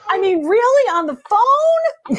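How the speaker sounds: mock offended. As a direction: pitch jumps way up, slowed, disbelieving. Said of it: "I should be offended".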